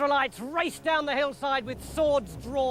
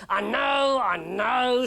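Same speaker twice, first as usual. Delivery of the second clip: agitated king; 4 dB louder, slower than usual. Acted. Saul agitated, shouting.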